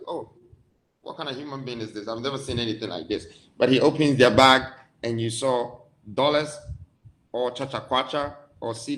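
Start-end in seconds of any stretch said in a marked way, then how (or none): 0.1-3.3 softer and fast, a startled, hushed double take; astonished murmur. Retold or imitated. Shopkeeper gawking at a strange alien customer, startled hushed murmur. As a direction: mutter it quickly under your breath, taken aback.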